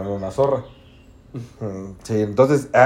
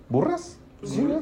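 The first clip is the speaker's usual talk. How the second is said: incredulous; 7 dB quieter, slower than usual. Real, not acted. Unsure asking about the word.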